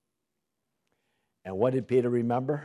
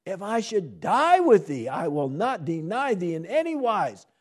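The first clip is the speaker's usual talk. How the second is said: vehement; 8 semitones higher, 6 dB louder than usual. Acted. Peter vehemently vowing to Jesus, loud. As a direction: swear it vehemently. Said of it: "spake the more vehemently".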